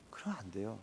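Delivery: very soft unvoiced whisper; hushed final warning; whispered plea. Real, not acted. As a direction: let it fade to a breath. Closing plea.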